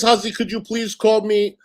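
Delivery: mock chatter request. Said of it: "someone says".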